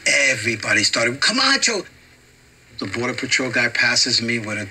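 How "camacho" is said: high excited cheer, a big jump up in pitch; crowd cheering. Retold or imitated. Border crowd recognizes the celebrity, high excited cheer. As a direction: cheer his name like a fan.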